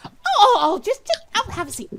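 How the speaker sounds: very high, loud, fading flustered babble; fluster, then settle; flustered Myrtle. Acted. Game master voices Myrtle fussing at the door, high and loud. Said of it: "She's like".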